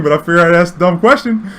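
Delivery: ordinary delivery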